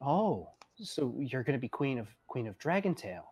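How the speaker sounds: soft, humouring question; Arthur. Acted. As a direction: quietly play along with a child. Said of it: "He goes".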